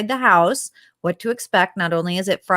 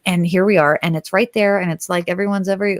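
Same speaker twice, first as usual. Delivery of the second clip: ordinary delivery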